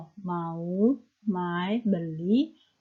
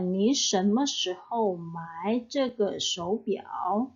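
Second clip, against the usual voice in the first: slow model sentence; slower than usual. Shown as a demonstration. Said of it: "Yang ketiga".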